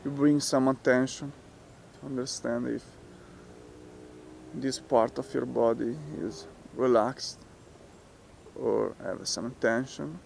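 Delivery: soft, unhurried meditation voice with long pauses; guided meditation. Real, not acted. Teacher talking listeners through relaxing the muscles, guided meditation. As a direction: guide them gently, leaving space after each phrase.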